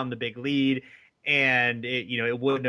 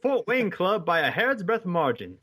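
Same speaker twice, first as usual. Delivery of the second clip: higher vintage radio announcer read; old-timey announcer voice; 8 semitones higher than usual. Acted. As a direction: call it like an old newsreel. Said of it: "read part of it in my old-timey, um, uh, announcer's voice".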